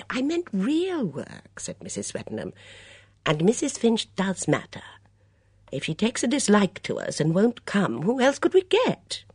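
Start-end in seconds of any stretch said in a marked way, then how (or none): 0.1-1.6 put-on high, lively voice of a fussy older lady; a bright, gossipy mother at breakfast; chatty Mrs Swettenham. Swettenham retort. 3.2-9.2 put-on high, lively voice of a fussy older lady; a bright, gossipy mother at breakfast; chatty Mrs Swettenham. Swettenham worried.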